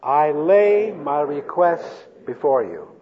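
slow, deliberate group recitation; measured, spaced-out phrasing for reciting together; leading recitation. Shown as a demonstration. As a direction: stretch each word so everyone can join in. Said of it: "Say that with me".

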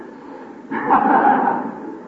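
loud high hearty fake laugh; big forced laugh. Shown as a demonstration. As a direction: lead the room in laughing.

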